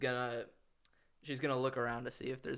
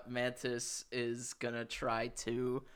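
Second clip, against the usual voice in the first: ordinary delivery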